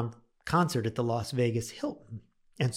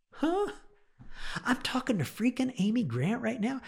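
very high, starstruck astonishment; amazed disbelief; 12 semitones higher than usual. Retold or imitated. Caller realises the singer herself answered, amazed. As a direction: squeak out a stunned question, acting it out. Stunned to reach the star.

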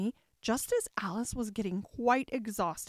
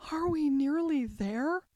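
higher-pitched, mildly weary question; panted question; 5 semitones higher than usual. A narrator's reading. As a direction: ask with just a hint of breathlessness. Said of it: "managed to pant out at last".

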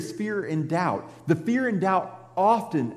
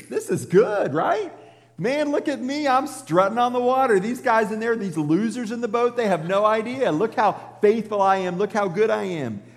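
cocky Peter; 6 semitones higher than usual. Retold or imitated. Peter boasting on water.

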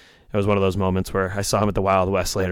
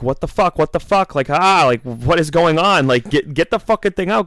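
pitched up high and loud, reliving the panic; imitating his own freak-out as the car burns; retold panicked yelling; 8 semitones higher, 4 dB louder than usual. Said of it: "So we're all freaking out".

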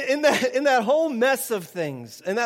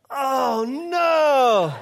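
laughing oh no; 4 semitones higher, 4 dB louder than usual. Retold or imitated. Neighbor hears he's Tom.

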